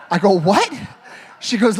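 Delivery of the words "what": laughing surprise. Retold or imitated. Retold shock at being drugged.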